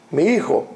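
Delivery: "mi hijo" with KH for the J; Castilian throaty J. Shown as a demonstration. Teacher giving Castilian for my son, "hijo" with KH. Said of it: "my son in Spanish, in Castilian".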